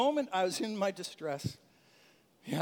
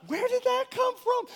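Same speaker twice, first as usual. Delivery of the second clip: surprised thought; 4 dB louder than usual. Retold or imitated.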